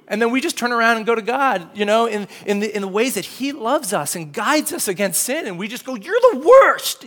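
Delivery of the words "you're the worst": put-on drawn-out high childish "you're the worst"; whiny kid. Kid protesting to his parent, slow and whiny. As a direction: mimic a sulking kid shaking his fist. Us complaining to God.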